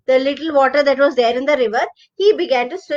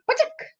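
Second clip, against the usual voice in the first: high sharp pachak; a quick splat noise; jump sound; 11 semitones higher, 7 dB quieter than usual. Acted. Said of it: "So he jumped".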